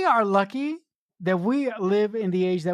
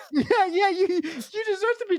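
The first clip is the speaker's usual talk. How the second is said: high laughing agreement; 12 semitones higher than usual. Real, not acted. Agreeing with the co-host while laughing, high.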